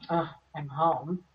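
slow, deflated sigh of a greeting; weary arrival. Pretend. Someone coming home to a house that drains them, flat and weary. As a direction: drag the words like you dread coming in. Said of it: "you walk up to it and you go".

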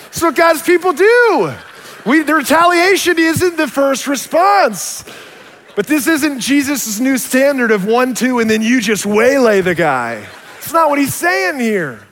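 fiery preacher rallying the congregation; preaching at full force. Real, not acted. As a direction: drive the point home at the peak. Preaching climax after story.

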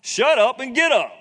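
shouted impatient command; annoyed command. Retold or imitated. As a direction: bark it down at a whiner. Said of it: "talking down to him".

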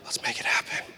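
whispered plotting; scheming whisper. Acted. Friend plotting a match with her crush, scheming whisper. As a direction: whisper it like a secret plan. Girls plotting to get the guy.

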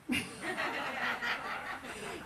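a soft breathy laugh. Real, not acted. Laughing as she tells the congregation about her husband. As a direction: laugh it off, amused.

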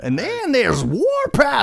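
high, slow cartoon robot voice; a hammed-up cartoon robot announcing himself; Warpath voice. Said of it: "three mini bots".

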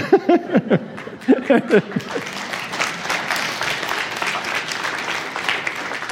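long soft airy laugh; drawn-out breathy chuckle. Real, not acted. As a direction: let the laugh roll out quietly. Laughs after calling him moron.

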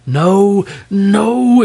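loud, high irritated king; irritable. A narrator's reading. King correcting the dragon, irritable. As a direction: burst out, impatient and high. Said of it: "exclaimed the king irritably".

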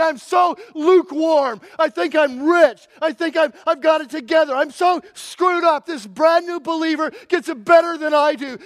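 loud, high, pleading cry to God; desperate repentant prayer. Pretend. Preacher voicing a lukewarm believer crying out to God, pleading. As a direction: beg for mercy from the gut.

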